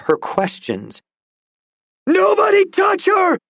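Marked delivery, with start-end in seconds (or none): 2.1-3.4 very high, loud desperate shout; bellowing shout. Acted. Dawson bellows at the crowd, desperate high shout. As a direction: roar it from the chest. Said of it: "His words bellowed from his chest".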